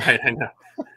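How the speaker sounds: laughing while agreeing. Real, not acted. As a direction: chuckle through the words.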